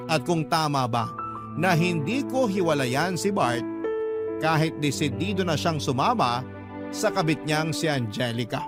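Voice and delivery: ordinary delivery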